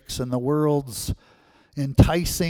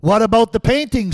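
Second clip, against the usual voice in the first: much higher, louder startled shout; shouted question; 8 semitones higher, 7 dB louder than usual. Retold or imitated. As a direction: call out in protest from the crowd. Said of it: "someone shouted".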